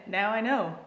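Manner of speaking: ordinary talk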